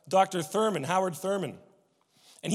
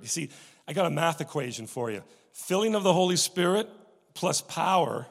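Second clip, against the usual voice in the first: normal talking voice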